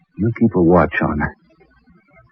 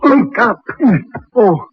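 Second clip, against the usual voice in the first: high, raised, urgent wake-up calls; urgent calling out; 12 semitones higher than usual. Acted. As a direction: shake him awake with your voice. Doctor urgently rouses sleeping George.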